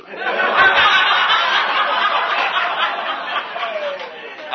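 long, high, airy laughter; real long breathy laughter. Real, not acted. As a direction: let the laugh roll on. Audience laughs at punchline.

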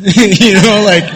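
high laugh bursting through. Real, not acted. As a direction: laugh at his own tension. Laughing at himself.

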